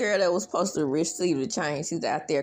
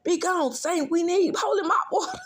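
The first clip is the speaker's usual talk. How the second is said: high, louder, wailing holy proclamation; hammed-up exorcist; 14 semitones higher, 5 dB louder than usual. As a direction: banish the demon, over the top. Janitor's mock exorcism.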